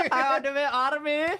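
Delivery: mock kid voice. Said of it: "kid video quote".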